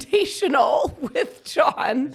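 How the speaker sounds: slow and high, laughing through it. Real, not acted. Telling what mom said, laughing through it. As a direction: break into a laugh on it.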